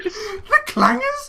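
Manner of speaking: high, slow disbelieving squeal; incredulous. Real, not acted. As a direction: stretch it out in total disbelief. Disbelief repeated, much higher.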